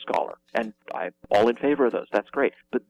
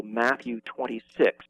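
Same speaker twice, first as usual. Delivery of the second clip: usual speaking voice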